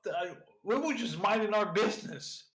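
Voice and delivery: a flustered captain protesting his ship; indignant captain. Acted.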